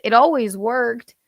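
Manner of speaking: ordinary talk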